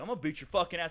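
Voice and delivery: heated mock menace; angry threat. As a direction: threaten him like a riled customer. Drunk customer threatens a beating.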